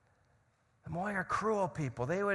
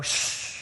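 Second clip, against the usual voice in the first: long, sharp "shh"; shushing; 4 dB louder than usual. Shown as a demonstration. Preacher showing how his culture silences people, shushing. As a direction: hush someone with a drawn-out hiss. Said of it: "we go".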